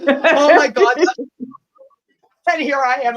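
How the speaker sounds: high and loud through laughter; laughing. Real, not acted. Laughing at her own mix-up with the chat, loud and high. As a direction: burst out laughing, barely getting words out. Cracking up at the story.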